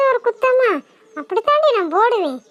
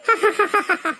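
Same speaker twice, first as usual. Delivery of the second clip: ghostly laugh; 4 dB quieter than usual. Acted. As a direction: cackle like a hidden ghost.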